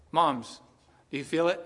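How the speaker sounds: ordinary talk, nothing marked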